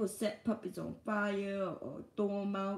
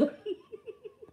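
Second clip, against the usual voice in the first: soft high laugh; 12 semitones higher, 7 dB quieter than usual. Real, not acted. Laughing at himself.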